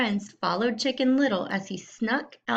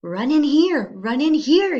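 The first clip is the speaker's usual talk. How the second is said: high, loud fox call; luring scream; 5 semitones higher, 8 dB louder than usual. Acted. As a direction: yell to lure them into the cave. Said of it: "he screamed".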